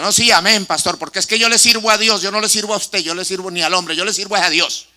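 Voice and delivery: loud, mocking scorn for the pastor; sarcastic scorn. Church member defying his pastor, loud sarcastic contempt. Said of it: "con ese tono sarcástico de desprecio hacia el pastor".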